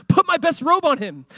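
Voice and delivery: high, slow joyful command; a father overjoyed, calling for the robe. Acted. Father welcoming his lost son home, joyful.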